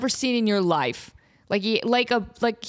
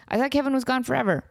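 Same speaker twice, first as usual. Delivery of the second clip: normal talking voice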